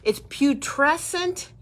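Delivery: putrescent drawn out slowly and high; stress on putrescent. Shown as a demonstration. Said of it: "This is the word I wanted to use".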